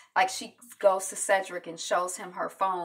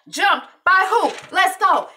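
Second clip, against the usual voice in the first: much higher and louder, itching for a fight; fired-up outrage; 12 semitones higher, 10 dB louder than usual. Retold or imitated. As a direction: shout like a riled-up teammate. Teammate ready to fight.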